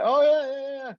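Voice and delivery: high, gushing mock enthusiasm; eager agreement. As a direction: act out an over-keen yes. Acts out eager answer about his mentor.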